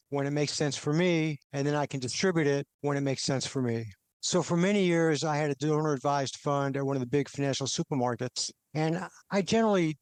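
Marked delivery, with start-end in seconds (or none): none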